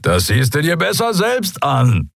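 high-pitched amused disbelief, called out; incredulously amused. A narrator's reading. Skria telling his mate to come and look, incredulously amused. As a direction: call back, amused and barely believing it. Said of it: "Der Skria klang ungläubig amüsiert".

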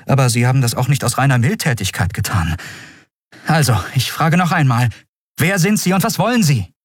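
high-pitched, insistent interrogation; insistent demand. A narrator's reading. Prisoner demands who he is and wants, insistent. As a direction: press harder until the last question peaks.

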